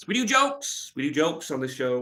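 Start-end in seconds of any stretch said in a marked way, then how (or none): 0.1-0.9 squeaky high. Acted. Repeats the line after a joke.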